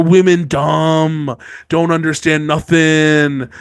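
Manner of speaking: slow, caveman-style lord; dumb brute voice. Retold or imitated. Lord mocking women at council, slow caveman voice. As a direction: play a dim brute lumbering through it. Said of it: "And they're all like".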